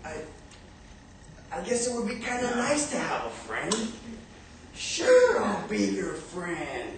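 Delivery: a giant who never had a friend, touched; shy lonely giant. Acted. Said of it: "And the giant said".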